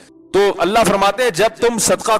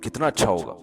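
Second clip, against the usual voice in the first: softer, lower coaxing wife voice; mock-loving wife wheedling for the house; sweet-talking wife; 5 semitones lower, 7 dB quieter than usual. Preacher imitates a wife coaxing her husband, softly coaxing. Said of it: "بڑی محبت سے کہیں گی".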